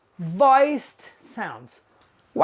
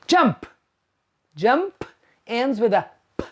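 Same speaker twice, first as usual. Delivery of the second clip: ordinary talk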